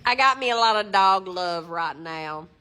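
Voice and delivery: Southern voice. Acted. Dog owner bragging about dog love, playful Southern accent. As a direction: put on a hammy Southern accent. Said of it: "I just like to do a Southern voice".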